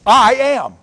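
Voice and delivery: sudden high, loud "I am"; booming proclamation. Acted. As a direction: proclaim it as God's own name. Climactic declaration of God's name.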